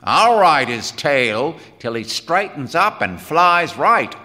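loud, hard-driving tirade; grim. Acted. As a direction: build to a forceful ultimatum. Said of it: "said Quinn grimly".

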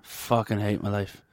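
low, soft, slow depressed mutter; depressed mumble. Retold or imitated. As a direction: moan it like a man at the bar. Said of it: "He's just like".

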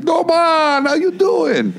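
high, goofy soldier greeting; mock voice. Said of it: "he's like".

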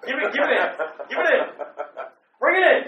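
high, loud rallying call; shouted egging-on. Real, not acted. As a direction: holler it to rally a partner. Egging co-host on.

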